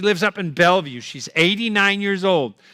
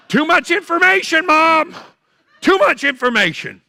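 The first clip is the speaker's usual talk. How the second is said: much higher, louder squeal of disgust; recoil, too much to hear; appalled outburst; 11 semitones higher, 4 dB louder than usual. Retold or imitated. Son shrieking at his mother's overshare, high and loud. Son reacting to dentures text.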